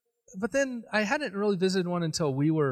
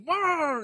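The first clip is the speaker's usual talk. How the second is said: high drawn-out "wah" roar; raised, open-mouthed roar; mock attacker roar; 10 semitones higher than usual. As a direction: imitate a lunging chainsaw man. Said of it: "he's like".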